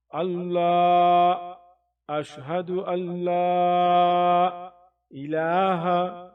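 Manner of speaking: slow, loud, stretched syllables; drawn-out vowels. Shown as a demonstration. Teacher stretches the vowels for students to follow. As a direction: draw each vowel out. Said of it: "teacher stretching vowels".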